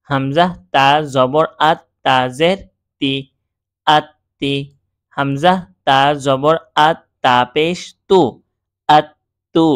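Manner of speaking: measured spelling drill with doubled consonants, as in আত্তি, আত্তু; each letter and vowel named before the joined আত্তি. Shown as a demonstration. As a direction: recite হামজা, তা, জবর in pieces, then join the syllables. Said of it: "সা পর্যন্ত বানান করে উচ্চারণ করলাম".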